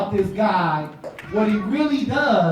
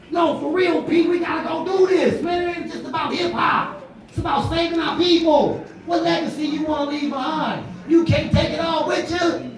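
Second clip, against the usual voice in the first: urgent shout; 8 semitones higher than usual. Retold or imitated. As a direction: shout him into action.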